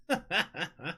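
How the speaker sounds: soft high giggle; laugh. Real, not acted. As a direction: chuckle lightly in quick pulses. Laughs at competitive streak.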